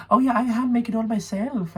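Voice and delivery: high-pitched mock shopkeeper; shopkeeper imitation. Chocolate shop woman to customers, high-pitched pride.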